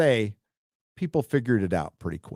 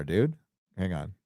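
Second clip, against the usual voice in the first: usual speaking voice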